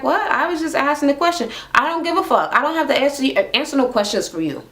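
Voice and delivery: high, sassy mock outburst; imitating belligerent rant. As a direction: throw attitude at the asker. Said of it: "she was belligerent, angry".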